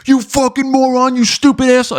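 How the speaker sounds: high, loud mock yell; mock screaming. Podcast hosts screaming insults at the guest, mock yell. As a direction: shriek the insults like an enraged host. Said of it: "they start screaming".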